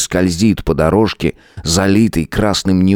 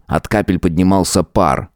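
plain reading voice

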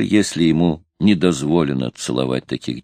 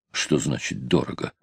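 low, softer probing question; 5 semitones lower, 7 dB quieter than usual. A narrator's reading. Sailor starting to bargain over the price, probing. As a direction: start haggling, keeping it quiet. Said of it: "выпытывал Джефф, начиная торговаться".

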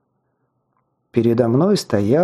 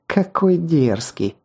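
slow, higher-pitched mocking jibe; snide sarcasm; 4 semitones higher, slower than usual. A narrator's reading. Red-haired girl jeering at his retort, slow sarcasm. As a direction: stretch it out with mock admiration. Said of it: "съязвила она".

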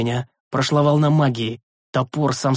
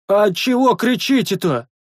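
much higher, louder call across the wall; shouted question; 8 semitones higher, 3 dB louder, slower than usual. A narrator's reading. A second sentry shouts his question across the wall. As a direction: yell the question from afar. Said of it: "громким криком поинтересовался".

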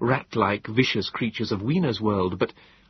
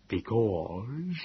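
slow, level Morlock voice; even Morlock reply; 7 dB quieter than usual. A narrator's reading. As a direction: keep it even and measured. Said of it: "he said evenly".

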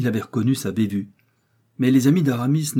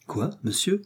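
sharp, higher startled question; indignant cry; 9 semitones higher than usual. Acted. D'Artagnan cries out at Aramis, higher and indignant. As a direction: bristle at the insult. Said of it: "s'écria D'Artagnan".

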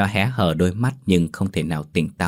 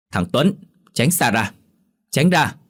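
higher, sharp shouted warning; sudden shout; 5 semitones higher, 4 dB louder than usual. A narrator's reading. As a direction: shout him away, louder on the last words. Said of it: "bà Lý bất thình lình quát to". (quát to bất thình lình)

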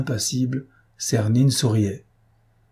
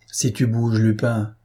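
usual speaking voice

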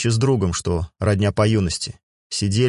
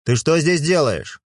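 stern; 7 semitones higher, slower than usual. Acted. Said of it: "хмуро смотрел на дочь".

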